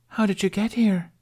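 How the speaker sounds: high, curious mermaid question. A narrator's reading. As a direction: ask brightly like a sea maiden. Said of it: "asked the third".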